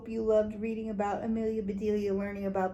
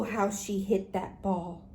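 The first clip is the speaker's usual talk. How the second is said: usual speaking voice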